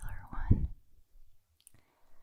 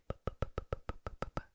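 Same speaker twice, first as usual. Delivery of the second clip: popping bup sounds; 3 dB quieter, faster than usual. Shown as a demonstration. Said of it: "I could go ahead and be like".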